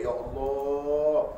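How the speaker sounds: high, drawn-out grateful wail; tearful thanks. Retold or imitated. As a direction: cry out thanks to God as the grateful poor man. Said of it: "menangis dia".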